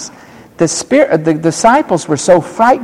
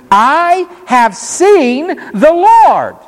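high, slow, awed announcement, much higher; excited Mary; 13 semitones higher, much slower than usual. Acted. Mary telling the disciples, high and awed. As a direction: announce it in wonder.

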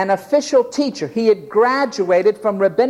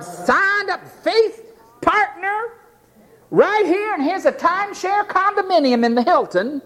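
evangelist salesman; 10 semitones higher than usual. Acted. Modern evangelist woos a rich recruit, mock-salesman. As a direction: parody an eager recruiter reeling off perks.

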